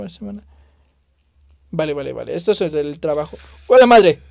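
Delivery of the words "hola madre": loud high burst of surprise; startled shout. Real, not acted. Gamer surprised by a creature, loud startled greeting. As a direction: blurt it out as the thing appears.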